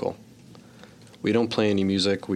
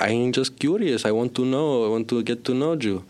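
put-on higher comic Belgian-Cuban accent; 4 semitones higher, faster than usual. Father wanting to know his son in role, Belgian-Cuban accent. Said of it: "unidentifiable Belgian-Cuban person".

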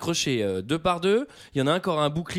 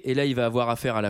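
normal talking voice

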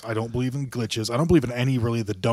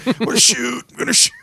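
much higher, squeaky movie-character cry; quoting movie; 12 semitones higher, 4 dB louder than usual. Retold or imitated. Squealing movie-character voice quoted to friends. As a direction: squeal it like the climax of the sex scene. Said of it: "So fucking good".

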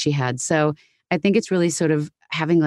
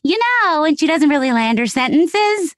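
much higher, airy voice with unlanded sentences; slowed, high and drifting Goldie Hawn; Goldie Hawn impression; 10 semitones higher than usual. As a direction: float it up without landing the ends. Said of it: "her voice is so".

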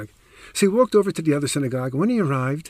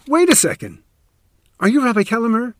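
much higher and louder, sudden realization; surprised realization; 9 semitones higher, 8 dB louder than usual. Retold or imitated. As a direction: catch him out with a startled, loud double take. Woman realizing who he is.

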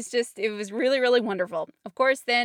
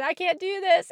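higher, voiced and louder plea; said again, strained; 9 semitones higher, 4 dB louder than usual. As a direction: push the words up in a strained plea. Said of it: "I think I said again".

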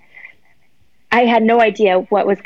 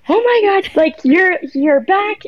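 high, thrilled exclaim; joyful reunion; 10 semitones higher, slower than usual. Retold or imitated. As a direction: greet a long-lost friend with delight. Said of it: "And I was like".

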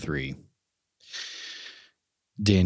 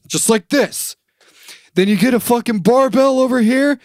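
much higher, louder drill-sergeant bark; Ultimate Warrior imitation; 6 dB louder than usual. Imitating the Ultimate Warrior training kids, loud and high. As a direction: boom it out like a ruthless trainer. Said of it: "He's like".

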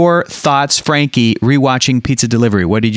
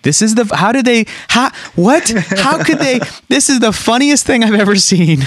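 put-on high, astonished voice of a kid; stunned disbelief, pitched way up; amazed kid; 8 semitones higher than usual. Retelling seeing it as a kid, high astonished voice. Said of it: "I was like".

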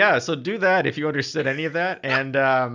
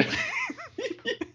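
high laugh; 10 dB quieter than usual. Real, not acted. Laughing at own joke.

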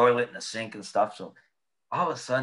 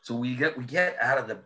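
usual speaking voice

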